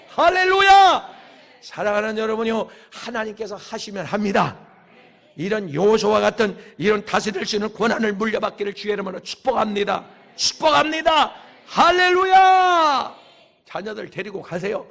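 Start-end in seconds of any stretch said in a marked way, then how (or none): 0.1-1.1 high, loud, drawn-out praise cry; full-force revival hallelujah; shouted hallelujah. Real, not acted. Preacher calls for response. 10.3-13.1 high, loud, building preacher's cry; full-force revival climax; shouted blessing. Real, not acted.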